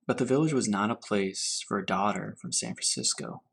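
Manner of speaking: unhurried storybook reading to children, lightly voiced; story reading. A narrator's reading. Teacher reading Grandfather's Journey to her class, gentle storytelling. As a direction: read it gently, letting each image settle. Teacher reads picture book aloud.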